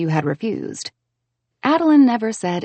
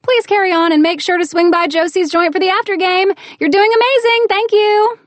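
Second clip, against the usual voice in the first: bright loud call; 12 semitones higher, 7 dB louder than usual. Acted. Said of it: "switching back to my voice".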